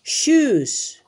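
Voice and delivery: shoes said high and loud with a long sh; emphatic model word. Shown as a demonstration. Teacher models shoes for children, loud and high. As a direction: call out shoes for the kids to copy. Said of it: "una vez más".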